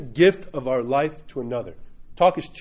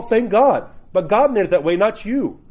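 normal talking voice

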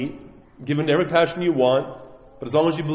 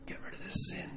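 whispered, voiceless inner voice; hushed whisper of the Lord; soft whisper; 20 dB quieter than usual. Pretend. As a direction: breathe it like a voice in the heart. The Lord knocking in the heart.

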